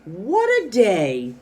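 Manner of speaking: high, loud, drawn-out delight; excited exclamation. Shown as a demonstration. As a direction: exclaim it as a happy team. Happy ending read with excitement.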